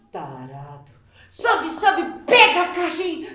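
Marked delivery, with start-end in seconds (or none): 0.1-0.9 very low, soft aside; muttered insult. Acted. Mother calling the boss a pervert, muttered aside. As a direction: mutter it under your breath.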